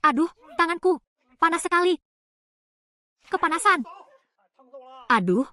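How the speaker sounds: high pained yelps; burning pain. Acted. Burned villager crying out about a hot hand. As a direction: cry out as if the hand is scorched.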